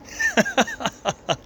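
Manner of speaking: high-pitched giggle; sudden high burst of laughter. Real, not acted. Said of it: "I shouldn't laugh".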